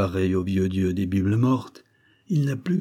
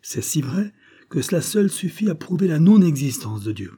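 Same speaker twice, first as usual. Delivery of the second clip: higher, louder, almost shouting it out; proclaiming loudly; 7 semitones higher, 4 dB louder than usual. A narrator's reading. Friend shouting his proof to the narrator, loud and high. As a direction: cry it out for all to hear. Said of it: "tu peux le crier".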